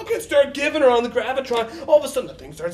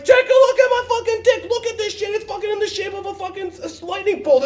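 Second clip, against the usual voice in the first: much higher and louder, outraged show-and-tell; shouting; 8 semitones higher, 4 dB louder than usual. Retold or imitated. To his buddy after the ride, a high loud shout. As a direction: yell at your buddy to look.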